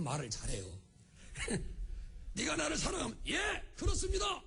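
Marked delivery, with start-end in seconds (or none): 2.3-4.4 high, emphatic acted question and answer; eager exchange. Acting the eager answer Peter should give. (높고 힘차게 대답하는 제자처럼)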